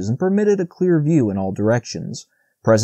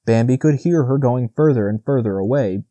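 ordinary delivery, nothing marked

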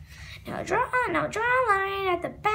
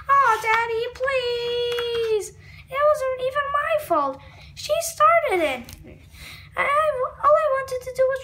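pleading; 6 semitones higher, 3 dB louder than usual. Acted. Melvin begging Daddy not to punish him, whiny.

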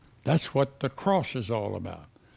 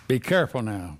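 ordinary talk, nothing marked

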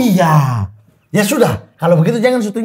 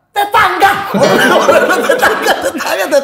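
laughing; 12 semitones higher, much slower than usual. Real, not acted. Laughing at the neighbour mix-up.

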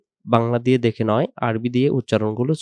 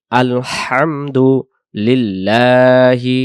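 আলহামদু লিল্লাহি slowly chanted, louder; slow recitation; 5 dB louder, much slower than usual. Shown as a demonstration. Teacher reciting the verse for students, slow chant. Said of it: "আমরা সূরাতে চলে আসি".

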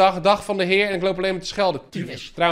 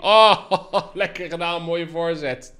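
laughing praise; slower than usual. Real, not acted. Laughing praise after play.

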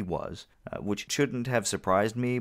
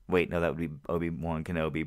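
usual speaking voice

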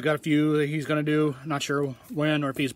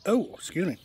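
hiccuping mid-word; hiccups; 4 semitones higher than usual. Real, not acted. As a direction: caught by a hiccup. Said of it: "There's the hiccups".

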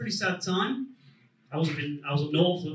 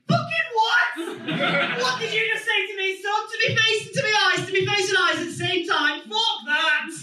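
mock outrage. Comic furious at beer pong rules, high mock outrage. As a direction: rage at the frat boy in disbelief.

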